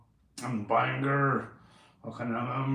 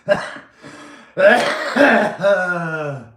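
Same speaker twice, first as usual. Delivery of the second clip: loud laugh; 4 semitones higher, 11 dB louder than usual. Real, not acted. Laughs at own sister joke.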